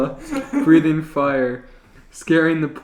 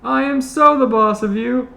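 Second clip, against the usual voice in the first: high defiant mock scold; 10 semitones higher, 5 dB louder than usual. Panty arguing with Chuck.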